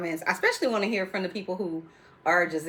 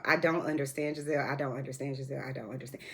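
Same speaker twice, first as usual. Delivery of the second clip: fading out; 5 semitones lower, 8 dB quieter, faster than usual. Real, not acted. Repeated, fading away.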